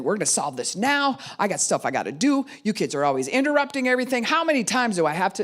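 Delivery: high, loud and fast scolding; busy parent scolding the kids angrily; angry parent. Retold or imitated. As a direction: snap at the kids in a hurry. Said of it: "a lot of times I'm mad".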